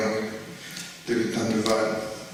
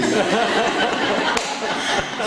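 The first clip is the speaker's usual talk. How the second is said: high burst of laughter; laughing; 10 semitones higher than usual. Real, not acted. Retelling the punchline of laughing.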